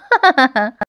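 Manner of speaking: short chuckle of amusement; amused laugh. Real, not acted. Narrator amused by the family's antics, chuckling. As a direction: let out a brief laugh.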